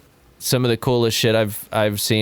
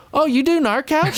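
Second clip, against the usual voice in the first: high-pitched, starstruck disbelief, retold; surprised recognition. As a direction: imitate someone amazed, pitch shooting way up.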